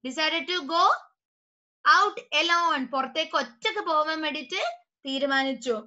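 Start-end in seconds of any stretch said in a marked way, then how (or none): none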